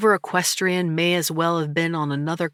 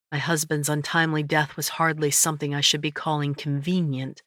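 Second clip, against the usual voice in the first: usual speaking voice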